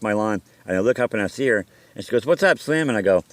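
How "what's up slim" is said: higher-pitched landlord greeting; retold greeting, pitched up; imitating landlord. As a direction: lightly mimic the landlord calling hello.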